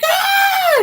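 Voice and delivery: high-pitched imitated shriek; mock scream. Sister caught by the vacuum, imitated scream. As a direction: let out a sudden high scream. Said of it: "because she's yelling".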